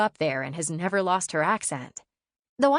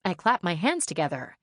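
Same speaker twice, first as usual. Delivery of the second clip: ordinary delivery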